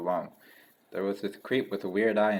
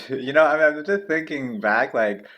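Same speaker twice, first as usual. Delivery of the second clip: laughing; 7 semitones higher, 8 dB louder than usual. Real, not acted. Player mocks the bully, laughing.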